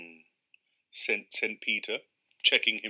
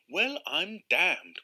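slow, higher exclamation of astonishment; astonished cry; 5 semitones higher, much slower than usual. Acted. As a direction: draw out the amazement. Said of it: "cried Mister Carswell".

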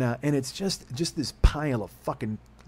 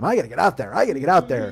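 emphatic self-urging; 3 semitones higher, 6 dB louder than usual. Retold or imitated.

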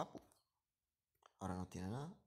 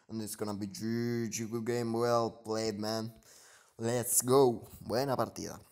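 victory shout; 12 dB louder, slower than usual. Real, not acted. Winning the match, celebrating.

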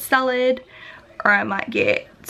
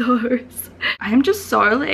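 ordinary delivery

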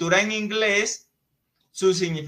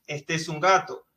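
ordinary talk

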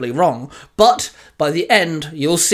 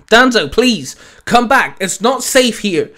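raised, higher pleading call; 6 semitones higher, 5 dB louder than usual. Acted. Winston begs Danzo to leave the danger, calling out. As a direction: call out urgently to a friend. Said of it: "Winston called out".

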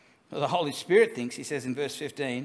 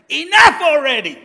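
loud, much higher exasperated yell; imitated shout; 12 semitones higher, 18 dB louder than usual. Youth worker shouting at the apologising thief down the phone, exasperated yell. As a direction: blast it down the phone, fed up. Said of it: "I wanted to shout down the phone".

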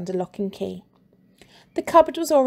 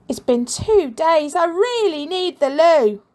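put-on high, loud whiny child; desperate boy; 8 semitones higher, 5 dB louder than usual. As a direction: whine like a kid who must go. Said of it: "said the boy".

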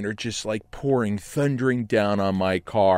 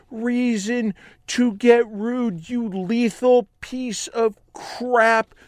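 high outraged rant; high-pitched fury, slowing on the insults; raging; 12 semitones higher, slower than usual. Retold or imitated. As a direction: tear into the drunk driver. Raging at drunk driver.

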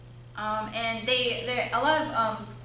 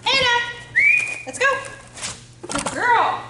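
excited calling dog; 13 semitones higher, 8 dB louder than usual. Real, not acted.

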